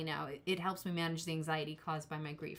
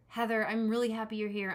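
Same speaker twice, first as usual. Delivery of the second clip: ordinary delivery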